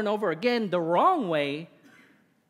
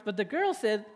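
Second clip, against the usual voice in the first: ordinary talk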